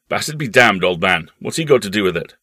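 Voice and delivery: put-on loud, dismissive older-relative voice; blustering uncle. Uncle dismissing the boy's promise, blustering.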